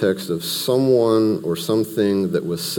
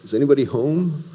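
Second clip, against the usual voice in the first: mock-incredulous, pitched up high; sarcastic; 6 semitones higher than usual. Paul mocking the Galatians, high sarcastic disbelief. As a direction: sneer it like they can't be this dense. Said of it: "a little bit of sarcasm".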